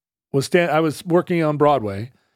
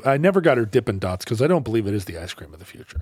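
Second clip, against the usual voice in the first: usual speaking voice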